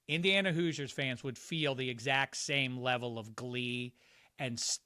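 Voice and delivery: normal talking voice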